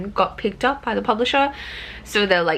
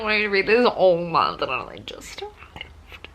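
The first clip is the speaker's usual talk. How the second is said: excited little whisper. Real, not acted. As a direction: breathe it out in a whisper. Excited whisper about book.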